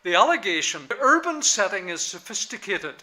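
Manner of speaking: allegation pressed hard and loud. Shown as a demonstration. Lecturer emphasizing the allegation to his class, loud and firm. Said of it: "let me just emphasize this one again".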